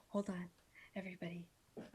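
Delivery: quietly, so as not to wake him; hushed aside to listeners. Real, not acted. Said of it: "you can't see him".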